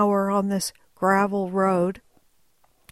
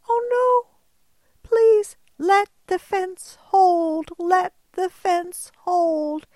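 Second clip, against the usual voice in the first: worried plea; 8 semitones higher, 4 dB louder, slower than usual. Retold or imitated. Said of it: "And I'm thinking".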